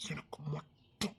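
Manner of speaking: slow, unvoiced possessive whisper. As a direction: breathe it into her ear, slowly. Possessive claim, voice drops. (மெதுவான உரிமை கிசுகிசுப்பு)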